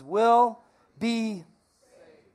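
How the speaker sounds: will be saved stretched very slowly; slow call and response. Shown as a demonstration. Pastor leading the church in repeating the verse, slow. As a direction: draw each word out for the crowd to echo. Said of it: "would you say it with me".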